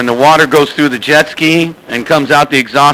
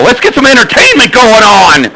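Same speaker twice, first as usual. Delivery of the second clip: higher, louder call to the channel; hyped announcement; 9 semitones higher, 4 dB louder than usual. Real, not acted. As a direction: get the whole channel fired up. Radio host hyping the channel.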